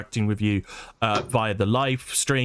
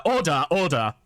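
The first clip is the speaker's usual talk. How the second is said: Commons Speaker; 4 semitones higher than usual. Pretend. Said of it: "U K politics".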